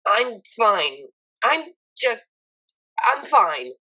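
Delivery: mildly annoyed mare deflecting; scowling. Acted. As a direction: snap that you're fine. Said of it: "scowled at her".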